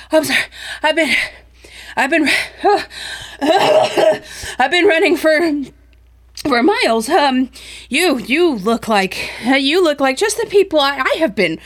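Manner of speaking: panting apology that keeps breaking into laughter; out-of-breath stranger blurting to the group, laughing; breathless frantic man. Acted.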